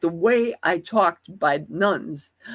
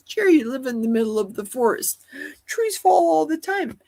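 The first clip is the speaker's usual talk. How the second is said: higher-pitched imitation of doubters; mimicking sceptical people; 7 semitones higher than usual. People dismissing Jerry's dream as common sense, mimicked higher. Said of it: "as people say".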